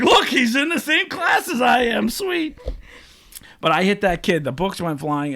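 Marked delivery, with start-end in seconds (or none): 0.0-2.6 high, loud gleeful shout; thrilled kid spotting his chance; excited. Retold or imitated. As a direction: crow it out like you just won. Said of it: "but I was like".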